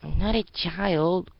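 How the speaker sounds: high, loud, slow protest; indignant little girl. Acted. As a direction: insist you're grown up.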